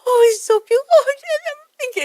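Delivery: very high, loud cooing; high baby-talk voice. Retold or imitated. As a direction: gush over a baby in an exaggerated squeal. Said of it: "it gets in that high voice".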